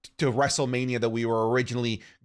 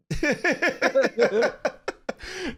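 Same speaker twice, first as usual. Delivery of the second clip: high, drawn-out laugh; real laughing fit; 12 semitones higher than usual. Real, not acted. Laughing at own embarrassing story.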